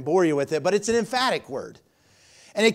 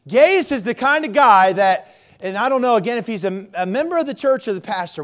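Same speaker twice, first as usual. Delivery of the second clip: preaching at full force; 5 semitones higher, 7 dB louder than usual. Acted. Preaching sermon.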